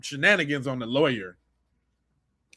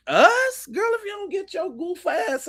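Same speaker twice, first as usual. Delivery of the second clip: incredulous squeal; 13 semitones higher than usual. Real, not acted. Disbelief at clip.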